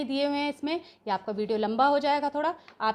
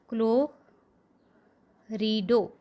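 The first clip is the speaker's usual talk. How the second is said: क्लोरिडो drawn out very slowly, falling at the end; slow dictation; much slower than usual. Shown as a demonstration. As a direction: stretch each syllable of क्लोरिडो for students to copy. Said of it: "वो क्या है आपका क्लोरिडो". (क्लोरिडो को बहुत धीरे खींचकर बोलना)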